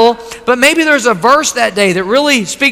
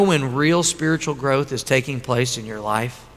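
hushed; 7 semitones lower, 9 dB quieter than usual. Real, not acted. Pastor drawing the congregation close, hushed and low. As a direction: drop to a soft, low, intimate voice. Pastor drops voice for key point.

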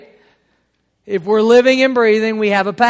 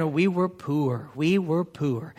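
softer Louisiana voice, "poor" stretched; Louisiana accent; 3 semitones lower, 8 dB quieter, slower than usual. Retold or imitated. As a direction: imitate mother's Louisiana accent quietly. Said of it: "she kept saying".

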